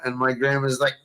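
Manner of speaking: ordinary talk, nothing marked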